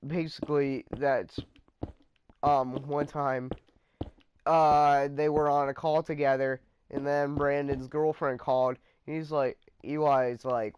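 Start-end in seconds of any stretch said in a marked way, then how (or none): none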